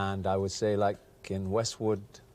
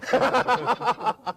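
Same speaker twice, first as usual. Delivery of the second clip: loud, high-pitched laugh; 13 semitones higher, 8 dB louder than usual. Acted. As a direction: laugh loudly, well above your usual pitch. Laugh during Hooray Harry act.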